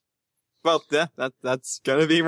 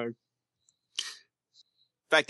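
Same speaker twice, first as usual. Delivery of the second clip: ordinary delivery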